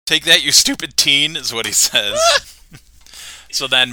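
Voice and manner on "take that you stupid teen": louder, higher mock battle cry; hammed-up shout while swinging a board; mock Homer shout. Homer quoted beating Moe.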